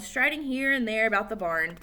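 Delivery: ordinary delivery, nothing marked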